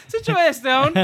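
high, laughing comeback; a laughing high-pitched sass back at the sister; laughing retort. Retold or imitated. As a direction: toss it back through a laugh. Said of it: "I'm like".

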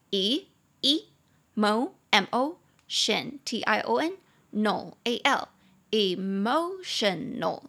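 slow, letter by letter syllable spelling; spelled out by syllable. Shown as a demonstration. Teacher spelling emotional slowly for the class. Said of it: "有四个音节".

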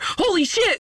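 hammed-up shock; mock surprise. Mock shock at obvious twist.

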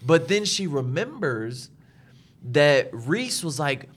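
normal talking voice

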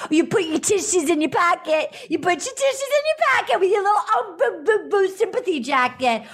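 high, singsong mock teasing; hammed-up baby-talk mockery; mocking taunt. Acting out contestant's taunting insult.

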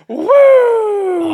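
very high, loud whoop as he's flung away; shrieking woo, far up in pitch; launched gnome's loud high yelp. Retold or imitated. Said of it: "Gnome goes".

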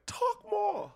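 high, far-off mock egging on; mock cheering. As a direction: squeak it out like a delighted fan. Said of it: "I was like".

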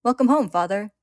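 put-on excited boy. A narrator's reading. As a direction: greet Dad eagerly as his little boy. Excited boy greets his father.

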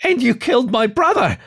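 high, grieving snarl. Acted. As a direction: accuse him with rising anger. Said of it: "snarled the fox".

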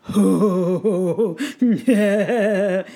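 bright, loud peal of laughter; ringing laughter. Acted. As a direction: laugh out joyfully. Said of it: "came ringing laughter".

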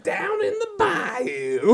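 high, drawn-out southern bayou cry; bayou holler. Acted. Co-host echoing the bayou joke, hammed-up southern drawl.

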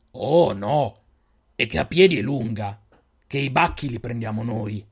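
slow, guarded stranger probing; a lightly voiced suspicious desert man; wary stranger. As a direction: size up the strangers slowly. Stranger reveals he'll take animals.

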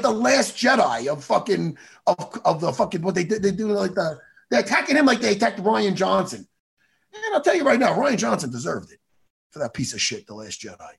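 normal talking voice

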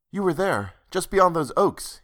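reach for the memory eagerly. A narrator's reading. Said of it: "he said eagerly".

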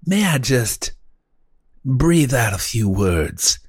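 halting, grandiose mock-genteel speaker; florid old-time orator. Host proffering a bold epigram, as a florid orator. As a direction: savor every pompous word with long pauses.

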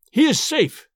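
high, loud cry of triumph; old spy gloating to his captor, crying out; triumphant cry. Acted. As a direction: burst out, stressing "he". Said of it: "he cried".

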